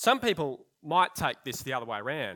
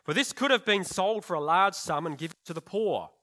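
louder, a touch higher disciples' grumble; indignant complaint; 4 semitones higher, 6 dB louder than usual. Acted.

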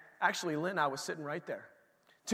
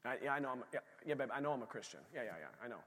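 much softer, lower mumble of agreement; Ananias answering wife; 7 semitones lower, 15 dB quieter, faster than usual. Acted. Ananias quietly answering his wife, much softer and lower. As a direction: quietly give in to her point.

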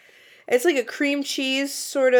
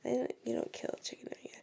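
fast, soft whispered aside; whispering; 2 semitones higher, 16 dB quieter, much faster than usual. Real, not acted. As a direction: whisper it quickly, half to yourself. Muttering while opening ice cream.

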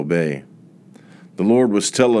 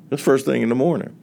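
usual speaking voice

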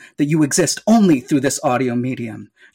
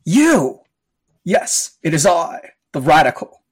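villain voice. Acted. Villain bursts in on podcast.

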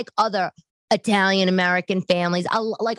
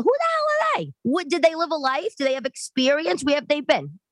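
ranting at social media experts, indignant and high; indignant, high outrage; 9 semitones higher than usual. Real, not acted. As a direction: pitch it up, incredulous and scornful. Indignant rant about fake experts.

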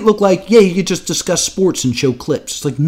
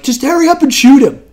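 impatient quote; 8 semitones higher, faster than usual. Retold or imitated. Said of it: "you can hear Adam being like".

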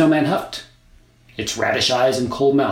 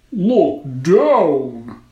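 loud snowman voice; 6 semitones higher, 9 dB louder than usual. A narrator's reading. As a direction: boom like a snowman. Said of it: "the voice said".

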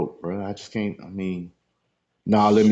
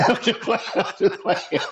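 real laughter through the words, pitched up; laughing; 9 semitones higher than usual. Real, not acted. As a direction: laugh it off while talking. Laughs off own joke.

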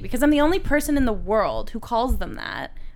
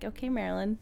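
soft, understated retort; dry comeback; 9 dB quieter than usual. Retold or imitated. Child answering "I'm your mother", dry comeback.

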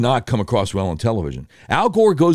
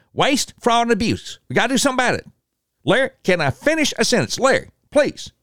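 Ross Perot impression; 12 semitones higher than usual. Perot interrupted on Larry's show, high and squeaky. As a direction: squeak it out like a flustered Texan billionaire.

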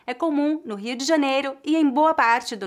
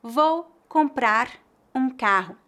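slow, comprar and um kept apart; careful word-by-word reading; slow separated; slower than usual. Shown as a demonstration. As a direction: say each word on its own slowly. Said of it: "Por exemplo".

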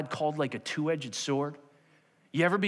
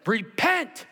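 loud, high cry of command; preacher's command; 9 semitones higher, 6 dB louder than usual. Retold or imitated. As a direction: proclaim it forcefully as Peter. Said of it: "And Peter said".

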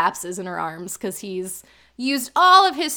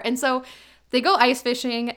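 ordinary talk, nothing marked

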